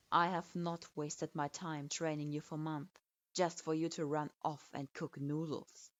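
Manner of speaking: derisive. Acted. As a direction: sneer at her dreams. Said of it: "His voice was filled with derision".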